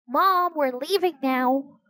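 high, drawn-out holler to mom; restrained girlish yell, stretched out; mild scream. A narrator's reading. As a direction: call through the house, slow and high. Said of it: "she screamed into the house".

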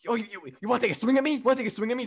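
high, fast mock-tough taunt; mocking Maverick's taunt. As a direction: egg them on in a squeaky tough-guy voice. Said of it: "the gimmick of him just going".

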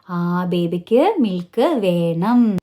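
slow modelled recast. Shown as a demonstration. Teacher models the full sentence for a child, very slowly. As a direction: model ബേബിക്ക് milk വേണം word by word, falling. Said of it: "different രീതിയിൽ പറയുകയാണ് കുട്ടിയോട്".

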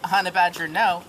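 normal talking voice

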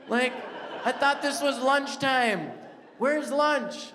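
high, loud, slow complaint; annoyed demand. Retold or imitated. Husband loudly demanding lunch from his wife, exasperated. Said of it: "And then I say it like this".

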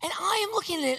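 normal talking voice

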